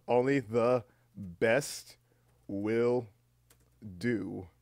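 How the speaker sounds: very slow, word by word; drawn-out dictation. Real, not acted. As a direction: stretch each word with pauses, as if typing it. Saying new title while changing it.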